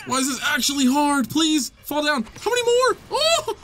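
high, frantic pleading; desperate. Real, not acted. Player begging the tough boss to fall, desperate. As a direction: plead in rising panic up to a squeal. Desperate during hard fight.

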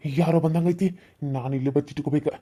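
ordinary talk, nothing marked

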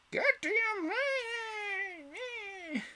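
queen screaming at everyone; shrill thunder. A narrator's reading. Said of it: "in a voice of shrill thunder".

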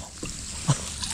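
soft, airy chuckle; short voiceless laugh; disbelieving laugh. Real, not acted. Angler seeing how tall they are, laughing in disbelief. Laughs at another huge fish.

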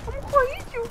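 high frantic panicked yelling; frantic, pitch shooting up; panicked excited. Real, not acted. Panicked while fleeing in game.

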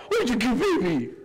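put-on laughing cooing baby talk; mock baby talk. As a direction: coo at a newborn, half laughing. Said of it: "he's gonna go".

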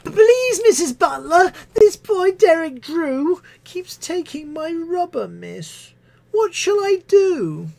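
put-on high, slow childish whine; whiny pupil. Pupil telling tales to Missus Butler, high whine. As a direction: plead like a tattling schoolchild.

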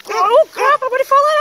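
high, loud panicked yelps as she nearly falls in; slipping toward the mud, crying out in real alarm. Real, not acted. Owner slipping at the swamp edge, panicked yelps. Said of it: "Actual panicking".

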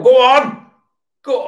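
sudden high, loud shout at the screen; excited cheer. Real, not acted. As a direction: cheer the team on. Football fan cheering at match.

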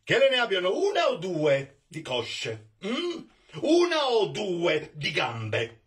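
high, gloating taunt with laughter; mocking master. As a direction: needle the servant, laughing at him.

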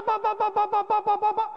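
rapid high-pitched pa pa pa, mimicking floors crashing; imitated collapse noises. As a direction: rattle off the crashes one after another, high.

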